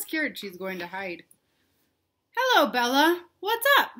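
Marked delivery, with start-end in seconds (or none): 2.4-3.9 higher, louder friendly greeting; cheerful Ben. Acted. Ben calling a cheery hello to Bella. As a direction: greet a friend brightly, up high. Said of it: "said Ben".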